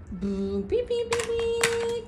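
high, playful engine and horn sounds; car noises. Pretend. Mother driving toy car for baby, playful beeping. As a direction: play at a car honking along.

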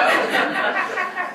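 high, loud burst of laughter; big real laugh. Real, not acted. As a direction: burst out laughing at your own story. Laughs at own story.

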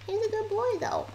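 high, cooing voice for a dog; pet baby talk. Acted. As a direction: coo it like talking to a puppy.

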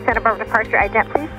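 ordinary talk, nothing marked

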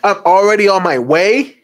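"way" swept upward as if appalled; incredulous repeat. Acted. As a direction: echo it in disbelief. Oh my gosh, these are the weirdest people.

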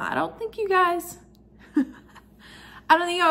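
high and laughing, tipsy. Real, not acted. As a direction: giggle through the words. Tipsy laughing mid-sentence.

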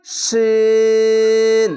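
সিন as sheen, sh from the middle palate; chant-like, drawn-out sheen; palatal shin. Shown as a demonstration. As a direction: hold the ee of sheen very long and level. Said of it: "তিন আলিফ টেনে পড়তে হচ্ছে".

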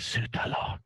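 soft, fast, urgent whisper; hissed whisper. Acted. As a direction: hiss it under the breath. NPC hisses in darkness.

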